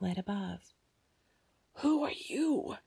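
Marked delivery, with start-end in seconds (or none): 1.8-2.8 put-on whispered higher old woman's hiss; old woman hissing at the girl. A narrator's reading. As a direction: hiss it at the intruder. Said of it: "the old woman hissed".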